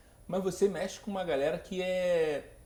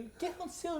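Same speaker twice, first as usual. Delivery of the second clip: alarmed questioning. Retold or imitated. Retells being questioned as child.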